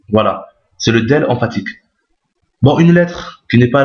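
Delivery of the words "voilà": ordinary talk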